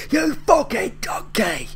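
high, slow furious chef yell; Gordon Ramsay shout. Retold or imitated. Reactor imitating Gordon Ramsay yelling at a cook, high furious shout. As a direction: bellow the insult like a raging TV chef. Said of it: "so many shows go around calling people donkey".

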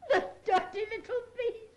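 crying out through tears; wounded, weepy outburst; tearful. Acted. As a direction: sob it out with indignation. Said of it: "don't cry".

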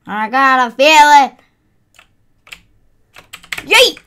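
high, loud battle cry; triumphant yell. Real, not acted. Gamer yelling while charging the last enemy. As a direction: shout it as you strike.

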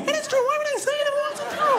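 very high, feeble pretend yell; arguing wife's yell. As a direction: squeal your comeback without any volume. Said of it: "the neighbors think I'm yelling at myself".

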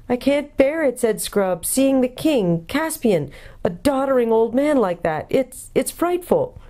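lightly voiced boy who can hardly bear it; a hint of an anguished boy; upset boy. A narrator's reading.

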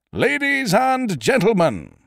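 high, slow stage announcer shout over the crowd; announcer's call. Acted. Compère calling to a restless music-hall crowd, high and drawn out. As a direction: call the house to order from the stage.

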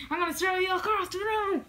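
high, fast furious yell; angry shout. Acted. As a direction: yell the threat in a rage. Angry threat to a rival.

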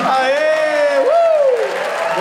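high excited cheer. Real, not acted. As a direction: whoop for the jackpot. Cheering the win. (comemoração eufórica)

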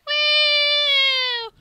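loud, very high "wooo"; long squealed woo. Acted. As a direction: squeal all the way through the flight. Voices the flung character.

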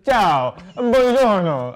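big, loud, high Italian greeting; yelled mock Italian. Shown as a demonstration. Guest teaching the host Italian, yelled mock Italian. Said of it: "you just have to yell words".

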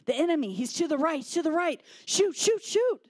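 high, loud rallying shouts; urgent, hammed-up war-game commands; declaring battle calls. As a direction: call out enemy positions to your comrade. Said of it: "declare to one another".

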